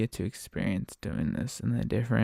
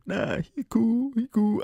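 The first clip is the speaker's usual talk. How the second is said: male passenger; 11 semitones higher, 4 dB louder than usual. Retold or imitated. Guy telling his partner the driver is fine, high mock voice. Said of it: "the guy's like".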